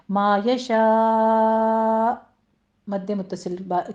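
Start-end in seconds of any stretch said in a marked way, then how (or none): 0.1-2.1 slow, drawn-out chanted recitation ending in a light stop; Quran recitation. Shown as a demonstration. Teacher demonstrating tajweed to students, slow recitation. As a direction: chant it slowly, then cut off gently. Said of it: "معمولی سا یہاں پہ stop کرنا ہے".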